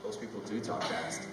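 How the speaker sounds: soft, amazed hush; quietly amazed; awed murmur. Retold or imitated. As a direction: marvel under your breath. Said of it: "And I was like".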